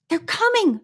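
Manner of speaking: high, voiced urgent warning; hushed-warning manner, not truly whispered; urgent stage whisper. A narrator's reading. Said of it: "she whispered".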